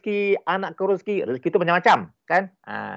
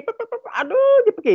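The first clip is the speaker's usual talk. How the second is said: mock overthinking; 4 dB louder than usual. Mimics a woman overthinking.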